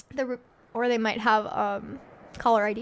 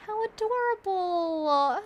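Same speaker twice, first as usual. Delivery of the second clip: cooing; 8 semitones higher, 4 dB louder, much slower than usual. Acted. Player voicing character, cooing.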